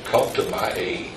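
pleading softly, voice sinking low; quiet plea. Acted. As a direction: hush the plea for help.

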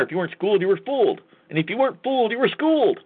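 mocking sing-song rhyme that rises to a peak; climbing mock rhyme. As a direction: ramp it up line by line like a taunt. Mocking rhyme about attorneys.

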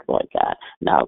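usual speaking voice

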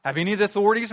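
loud, high, scornful challenge; haughty Pharisees. Acted. Pharisees berating their own officers, loud and haughty. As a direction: sneer down at them from your authority. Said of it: "They just got out of their mouth".

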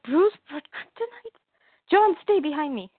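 high, worried protective voice; alarmed mother voice. Retold or imitated. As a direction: sound startled and shield your son.

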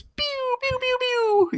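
very high pew-pew noises; squeaky little firework sound effects; imitated fireworks. Host shows off an imagined party trick, high imitated fireworks.

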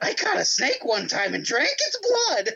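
high-pitched silly giant's voice; Braun Strowman. Retold or imitated. Fan imitates a podcaster's wrestler impression, high silly voice. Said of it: "he'll do the voice of Braun Strowman talking".

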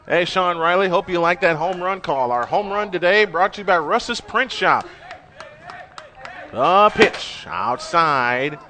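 ordinary talk

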